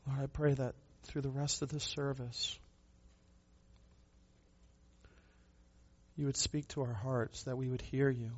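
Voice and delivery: soft, earnest prayer voice; hushed pastoral prayer; closing prayer. Real, not acted. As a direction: pray quietly for the congregation.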